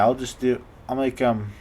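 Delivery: usual speaking voice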